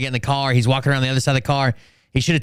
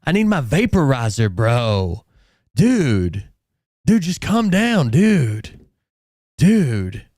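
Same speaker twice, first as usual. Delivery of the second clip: lightly voiced frat bro; a hint of a pleading frat bro; much slower than usual. Voicing a stoned driver's pleas.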